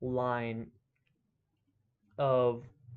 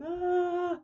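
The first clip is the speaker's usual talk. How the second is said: high, far-off hammed-up cry of falling; imitated scream as he drops away; mock falling scream. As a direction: yelp like a wizard plunging into the dark. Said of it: "and he's like".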